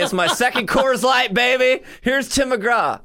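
playful put-on country radio voice; mock tipsy DJ. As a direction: ham up a beer-drinking radio host on air. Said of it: "it's slur words. Just say".

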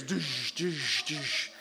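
low, soft thudding "doosh" sounds; imitated footsteps. Somebody coming down the stairs, imitated footfalls. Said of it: "somebody coming down the stairs".

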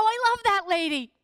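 high, loud burst of affection; gushing enthusiasm. Retold or imitated. Wife answering her husband about a favorite Bible lady, gushing. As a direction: gush it out, loud and high-pitched.

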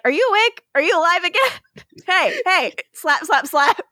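high teasing voice; mock wake-up call. Damon waking Stefan in a recap, high and teasing. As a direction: poke a sleeping friend, high and playful. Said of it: "He's like".

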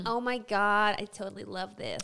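low-pitched mock American gush; American accent. Shown as a demonstration. Co-host showing her favourite accent, mock American accent. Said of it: "Spanish and American".

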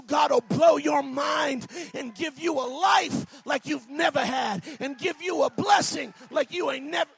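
shouted revival sermon; preaching at full force. Acted. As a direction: preach it hard to the congregation.

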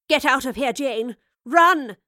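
loud shout peaking on the last word; urgent cry. A narrator's reading. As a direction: cry out urgently to make someone flee. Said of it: "the professor cried".

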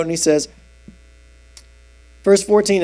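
ordinary talk

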